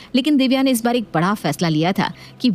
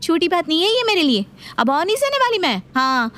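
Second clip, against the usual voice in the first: higher, building defiance; heated threat; 9 semitones higher than usual. Acted. Daughter-in-law threatening mother-in-law, higher and heated. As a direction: stand your ground.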